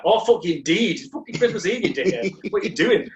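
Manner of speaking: imitated exasperated rant, pitched high; mock an outraged housemate telling you off; high, incredulous retold scolding; livid housemate. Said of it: "he was so livid".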